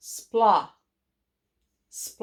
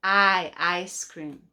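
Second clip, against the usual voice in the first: ordinary delivery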